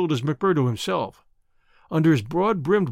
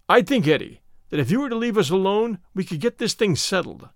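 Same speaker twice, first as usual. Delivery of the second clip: plain reading voice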